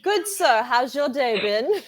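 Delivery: attempted posh British accent, slowing down; copied British accent. Shown as a demonstration. American copying the British host, attempted posh British accent. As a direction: try a posh British accent, drawing out the end. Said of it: "I'll try to copy you".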